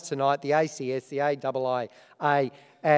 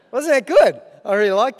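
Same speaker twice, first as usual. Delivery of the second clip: real laugh, then much higher and louder delight; laughing at own joke; 6 semitones higher, 8 dB louder than usual. Real, not acted. Host laughing at his own punchline to the awards crowd. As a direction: burst out laughing, pleased with himself.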